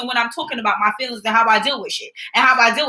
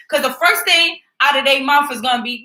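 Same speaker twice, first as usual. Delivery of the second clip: ordinary delivery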